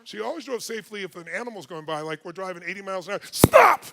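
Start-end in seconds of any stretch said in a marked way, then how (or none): 3.3-3.8 very loud, high-pitched yell; sudden panicked shout. Retold or imitated. Wife spotting an animal at eighty, sudden yell. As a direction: yell it as an emergency halt.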